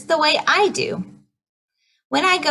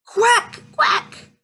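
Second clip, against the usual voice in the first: high shouted quack; duck quack; 11 semitones higher than usual. Retold or imitated. Duck noise in storytime.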